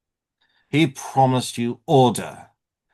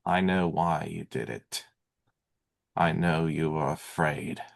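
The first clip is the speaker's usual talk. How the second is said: soft, low reassurance; understanding; 4 semitones lower, 6 dB quieter than usual. Acted. Speaker to frightened citizens who gave in, quiet understanding. Excusing the listeners' fear.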